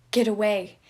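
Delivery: loud shout of "Get away!"; the voice in his head yells at Janice. Acted. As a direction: bark the order at him. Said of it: "it shouted".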